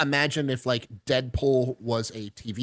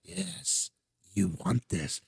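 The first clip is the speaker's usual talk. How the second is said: lightly voiced hushed Palpatine; a hint of a tempting Sith whisper; tempting devilish whisper; 3 semitones lower, 7 dB quieter, much slower than usual. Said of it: "He goes".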